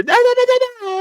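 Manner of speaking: mock squeaky cartoon voice, way up high; high light voice. Reacting to the dub actor's light voice, squeaky high mock voice. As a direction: imitate a voice that sounds far too light. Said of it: "such a, like, light voice".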